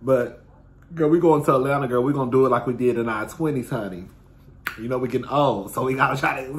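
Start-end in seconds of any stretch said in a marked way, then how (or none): none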